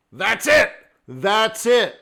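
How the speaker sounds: triumphant, punchy shout; acted eureka. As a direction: hit it like a sudden confirmation. Acting trader's moment of recognition.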